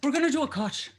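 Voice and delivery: imitated Irish teacher's voice; light mock-Irish lilt; Irish accent. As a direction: put on an Irish accent, playfully. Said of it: "I'm gonna use my Irish accent".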